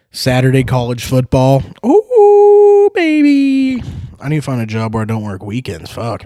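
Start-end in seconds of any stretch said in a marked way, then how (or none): 1.9-3.8 high, loud squeal of joy; excited whoop. Real, not acted. Fan hyped for college football Saturday, squealing whoop. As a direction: let out a giddy whoop.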